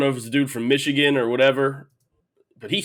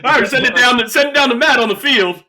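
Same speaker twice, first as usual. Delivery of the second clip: higher, louder TV anchor handoff; sportscast anchor; 9 semitones higher, 8 dB louder than usual. Retold or imitated. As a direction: toss it to the field reporter. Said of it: "You do sound like ... a live reporter".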